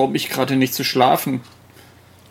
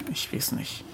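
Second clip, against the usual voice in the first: breathy little whisper; 5 semitones lower, 14 dB quieter than usual. Acted. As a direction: whisper it to yourself. Resigned aside mid-conversation.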